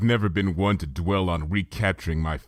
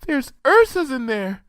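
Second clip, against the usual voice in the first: high, small worried warning; a timid pony fearing monsters; frightened Fluttershy; 4 dB louder than usual. A narrator's reading. Fluttershy warning Twilight, timid.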